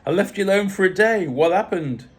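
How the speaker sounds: high, loud, bouncy greeting; puppy-dog excitement. A narrator's reading. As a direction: pounce on them with boisterous, high-pitched energy. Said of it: "Lizzie bounced out in full puppy dog mode".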